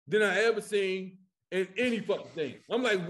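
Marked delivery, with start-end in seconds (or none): none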